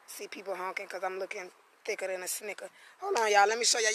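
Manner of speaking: ordinary talk, nothing marked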